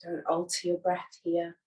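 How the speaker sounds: unhurried, soothing yoga nidra voice; guided meditation. Acted. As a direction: guide them gently into rest, leaving long silences. Leads a guided relaxation.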